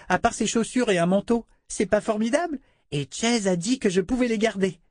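put-on high, eager little girl's voice; a delighted small child gushing; child voice full of admiration; little girl Rachel.

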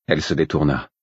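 plain reading voice

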